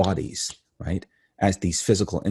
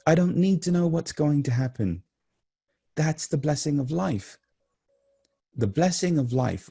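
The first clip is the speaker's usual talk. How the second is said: high, fervent spiritual exhortation; impassioned preaching; 8 semitones higher than usual. Real, not acted. Speaker urging listeners to embrace the unknown, impassioned preaching. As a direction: lift the voice high with conviction.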